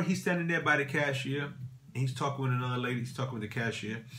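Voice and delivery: usual speaking voice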